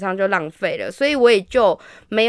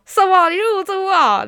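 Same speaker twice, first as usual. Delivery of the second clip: higher mock shout; retold shocked yell; 9 semitones higher than usual. Retelling to friends, mock shocked yell. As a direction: replay the shocked cry lightly. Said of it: "居然是对他吼说".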